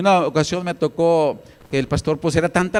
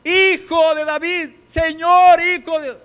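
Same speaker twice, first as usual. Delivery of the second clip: high, pleading shout; crying out; 11 semitones higher, 4 dB louder, slower than usual. Acted. Woman calling after Jesus as he passes, shouting. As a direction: call loudly after someone passing by. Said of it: "¿Se acuerdan también quién gritó?".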